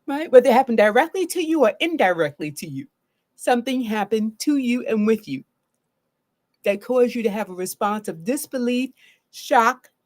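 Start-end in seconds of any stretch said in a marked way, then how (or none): none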